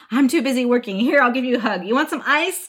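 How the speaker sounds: laughing at her own bad parenting; mocking herself. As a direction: ham up the distracted mom. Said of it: "felt like the worst parent".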